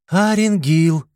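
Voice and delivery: loud call up to the tower. A narrator's reading. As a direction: call his name upward, high. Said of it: "крикнула она". (громкий оклик снизу вверх)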